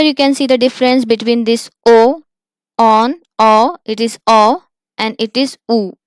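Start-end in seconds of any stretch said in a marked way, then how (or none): none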